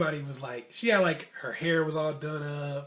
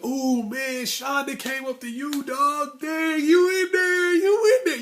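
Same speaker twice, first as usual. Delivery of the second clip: high, loud, hooting friends; hammed-up playground cheering, slowed and stretched; excited hype; 11 semitones higher, 9 dB louder, slower than usual. Friends cheering him after Shonda talks to him, loud and high.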